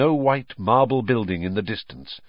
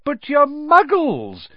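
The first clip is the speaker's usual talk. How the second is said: slow, high, loud delight; 8 dB louder, slower than usual. A narrator's reading. Mr Weasley meeting Hermione's parents, delighted. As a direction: marvel loudly, pitched right up. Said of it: "said Mister Weasley delightedly".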